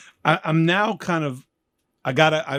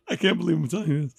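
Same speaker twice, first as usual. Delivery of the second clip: real laugh in the voice; quick, softly chuckled confession; amused disbelief; 4 semitones higher, 4 dB quieter, much faster than usual. Real, not acted. Laughs at his own confession.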